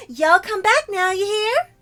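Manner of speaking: high, loud cutesy Southern sign-off; chirpy country gal farewell; southern uwu voice. Acted. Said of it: "the southern accent uwu voice".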